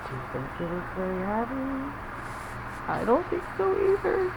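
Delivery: put-on lively read-aloud to children; story reading. A narrator's reading. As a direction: read it like a picture book for little ones. Reading picture book to kids.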